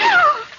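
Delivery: sharp, high gasp of fright; startled gasp. Acted. Captive startled as the madman appears, high gasp. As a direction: gasp loudly in shock.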